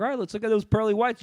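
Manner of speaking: high, cheery dentist voice; imitating dentist. Retelling the dentist's reveal, high cheery voice. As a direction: chirp it like a jolly dentist. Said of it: "It's like".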